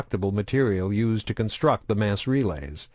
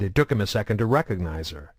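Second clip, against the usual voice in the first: usual speaking voice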